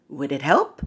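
low, soft, deadpan reply; calm Russian spy. Retold or imitated. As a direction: shrug it off, unbothered. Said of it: "so calmly".

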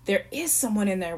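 ordinary delivery, nothing marked

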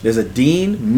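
ordinary talk, nothing marked

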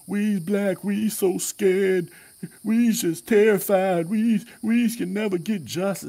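high, slow, whiny mock victim voice; exaggerated high pleading parody of a scared spokesman; mocking Van Jones. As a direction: lampoon a TV pundit wailing about fear. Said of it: "they'll watch Van Jones and others get on TV".

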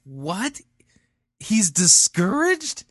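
high, loud, drawn-out incredulity; astonished disbelief. Real, not acted. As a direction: blurt it back in utter disbelief. Astonished at preacher's claim.

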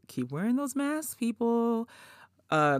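ordinary talk

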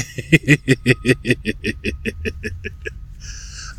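long unvoiced chuckle; breathy laugh. Real, not acted. After saying the harsh truth, a long breathy laugh.

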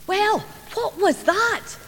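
put-on high, slow, eager little-girl voice; excited child asking. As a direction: bounce on it like an excited wee girl. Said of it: "jumping up and down".

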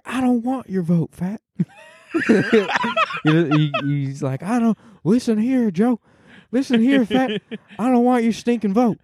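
high mock Biden rant about soup; Joe Biden impression. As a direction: scold the voter like Biden at a town hall. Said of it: "a solid Joe Biden".

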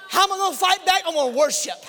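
shouted, high fervent preaching; preaching at full force. Acted. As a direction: roar it like a revival preacher.

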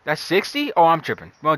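ordinary talk, nothing marked

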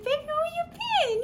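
very high, cooing parent voice; high-pitched fake sweetness; sweet mock concern. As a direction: coo it high, feigning innocence. Sweetly greeting knocked-out child.

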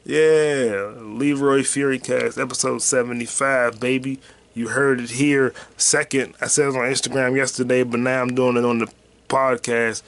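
usual speaking voice

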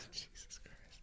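breathy exasperated whisper. Real, not acted. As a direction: whisper it in disbelief. Exasperated at headline-only news.

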